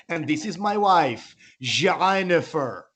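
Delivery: slow, showy put-on Iranian accent; grand introduction in mock Iranian accent. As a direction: stretch "Jennifer" in a fake Iranian accent. Friend shows off exoticised names.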